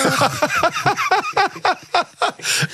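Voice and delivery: long, high-pitched burst of laughter; hearty laugh. Real, not acted. Guest reacting to a joke, high laughter.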